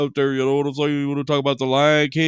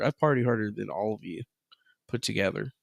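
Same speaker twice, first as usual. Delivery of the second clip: whisper; 4 semitones lower, 7 dB quieter than usual. Acted. Boastful whispered brag.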